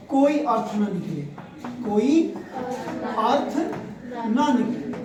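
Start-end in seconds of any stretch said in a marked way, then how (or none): 1.8-4.9 word-by-word dictation; dictating. Real, not acted. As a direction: leave a long pause after each word.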